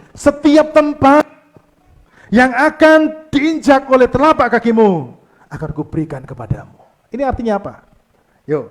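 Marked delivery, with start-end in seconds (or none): 0.1-6.7 booming divine promise, higher and louder; God's voice. Acted. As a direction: declare it as God making a promise. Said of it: "Tuhan berkata gini".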